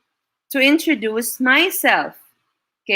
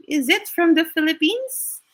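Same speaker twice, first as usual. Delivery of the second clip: higher-pitched learner's query; mock puzzled question; 7 semitones higher than usual. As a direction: ask it high, as a wondering student.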